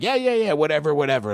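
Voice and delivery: high, impatient dismissal; retold brush-off. Jeff brushes off the new manager's conditions, dismissive. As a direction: wave it all away, starting high.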